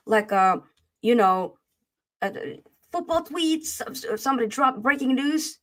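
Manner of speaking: ordinary talk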